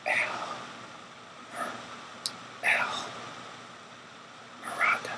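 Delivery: hushed whisper, barely voiced. A narrator's reading. Prisoner leaning close to whisper a name, whispered. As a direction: lean in and breathe the name. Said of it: "quenches his voice to a mere whisper".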